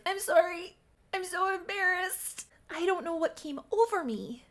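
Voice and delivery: high, squeezed mock embarrassment; flustered apology. Charlotte apologising after kissing Leif, flustered.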